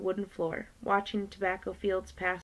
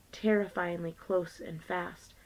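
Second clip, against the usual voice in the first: plain reading voice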